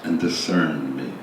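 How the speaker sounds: slow, low meditative reading with long pauses; slow psalm reading. Real, not acted. Leader reads a psalm for listeners to experience, slowly. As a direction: read it slowly and low, phrase by phrase. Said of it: "I'm gonna read these things slowly".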